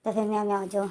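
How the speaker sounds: ordinary delivery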